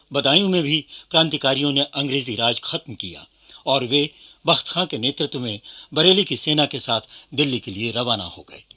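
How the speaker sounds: normal talking voice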